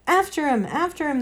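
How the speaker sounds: high excited hunting cry; shouting. A narrator's reading. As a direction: call out like a hunt in full cry. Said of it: "they shouted".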